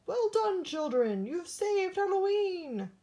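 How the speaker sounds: high, loud triumphant cheer; cheering cry. Acted. The creatures thank the children, with a high, loud cheer. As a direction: cheer it out like a grateful crowd. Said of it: "and they cried".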